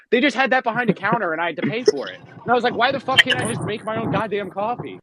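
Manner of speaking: high-pitched, incredulous griping; exasperated rant. Retold or imitated. Customer recounts being charged for hotel coffee, exasperated rant. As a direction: let the voice climb in comic outrage.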